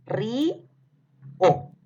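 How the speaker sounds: "Ri-o" split in two with a clear break; syllable split. Shown as a demonstration. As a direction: pull "Ri-o" apart like a teacher. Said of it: "se separa de esta forma".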